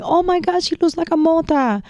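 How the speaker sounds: high, delighted gush over a cute puppy; delighted exclaim. Retold or imitated. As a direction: squeal it like you just saw a fluffy puppy. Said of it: "I'm going".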